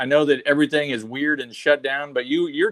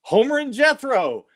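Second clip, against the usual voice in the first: high, defensive mock-teen reply; teenage self answering; 10 semitones higher than usual. Teenager caught playing a record by mom, high-pitched reply. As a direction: answer back in a pitched-up youngster's voice.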